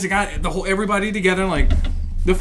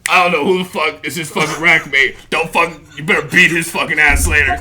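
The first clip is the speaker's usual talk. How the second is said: deep, low drill-instructor threat; Staff Sergeant DeBell; 8 semitones lower than usual. Retold or imitated. Drill instructor ordering rack mate to deal with him, low imitated voice. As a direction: imitate the sergeant, pitched well down. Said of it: "he goes".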